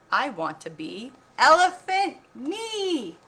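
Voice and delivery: high, loud and slow declaration; brave little elephant. A narrator's reading. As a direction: proudly announce the new name, drawn out. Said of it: "Num Num said".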